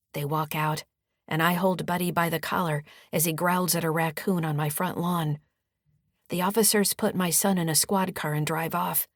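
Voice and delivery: usual speaking voice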